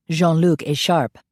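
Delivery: ordinary delivery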